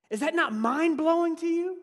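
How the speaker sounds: ordinary delivery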